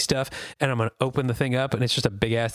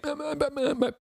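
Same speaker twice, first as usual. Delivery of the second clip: silent space noises; 13 semitones higher than usual. Pretend. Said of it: "he'd just be like".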